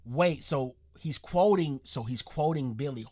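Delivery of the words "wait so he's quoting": usual speaking voice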